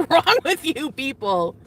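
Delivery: ordinary talk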